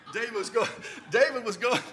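laughing while pleading, half-joking; laughing plea. Retold or imitated. Player to the coach, laughing while blaming David. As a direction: giggle it out, pointing to your buddy.

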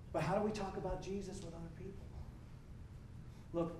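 ordinary talk

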